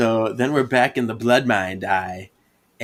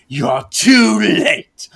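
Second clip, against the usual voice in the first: higher, louder, slow taunt; gloating shout; 9 semitones higher, 7 dB louder, much slower than usual. Acted. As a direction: drag it out loud and higher. Patience gloats, acted comic quote.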